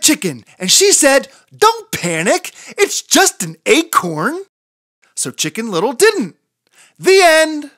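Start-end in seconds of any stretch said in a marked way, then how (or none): 1.5-4.5 bossy reassurance. Acted. Chicken interrupts to calm. 7.0-7.7 high, loud, proud "the end"; triumphant ending. Acted. Chicken proudly ends the story, high and loud.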